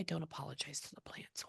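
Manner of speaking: breathy whisper with no voice. Real, not acted. Medium whispering the spirit's words to herself. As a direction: murmur it under your breath, almost silent.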